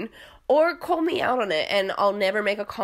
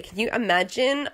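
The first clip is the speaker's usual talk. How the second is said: normal talking voice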